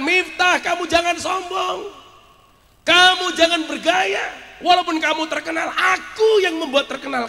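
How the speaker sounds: high, loud, commanding divine rebuke; Allah rebuking. Acted. God warning Miftah not to be arrogant, thundering rebuke. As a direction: thunder a stretched-out warning against pride. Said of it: "kata Allah".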